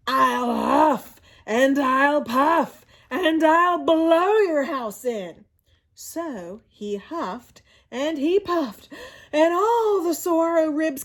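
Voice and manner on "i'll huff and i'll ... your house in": slow, loud, drawn-out villain voice; big bad Coyote threat. Coyote menacing the javelinas, slow and loud. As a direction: stretch every word like a hungry predator.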